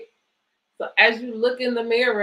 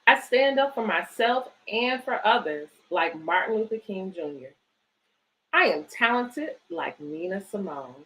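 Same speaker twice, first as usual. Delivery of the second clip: put-on reading to children. Reads picture book aloud.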